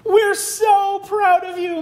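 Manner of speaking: proud mom. Acted. Mom praises Otto.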